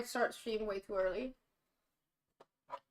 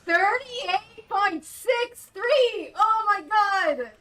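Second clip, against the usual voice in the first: slow, loud, high-pitched disbelief; amazed shouting; 6 semitones higher, 7 dB louder, much slower than usual. Real, not acted. Streamer reads a surprise number, amazed.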